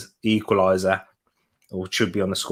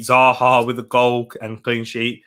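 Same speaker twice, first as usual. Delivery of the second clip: ordinary talk, nothing marked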